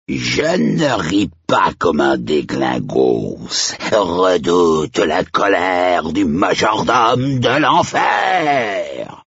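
slow threat rising to a shout; theatrical villain, drawn out and swelling; menacing hellish majordomo. Acted. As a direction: build to a high, booming finish.

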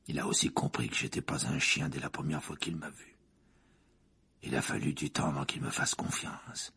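low rasping whisper of a haunted man. A narrator's reading. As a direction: breathe it out low and rough.

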